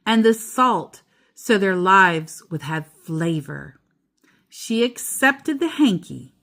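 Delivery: steady storybook read-aloud; reading to a child. A narrator's reading. Parent reading The Keeping Quilt to child, storybook voice. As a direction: read it gently to your child at bedtime.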